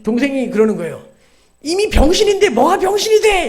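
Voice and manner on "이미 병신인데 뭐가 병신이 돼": put-on high, loud shouted comeback as the kid brother; brother's retort. Younger brother yelling back at his big brother, high shout. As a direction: squeal it back like an angry little kid.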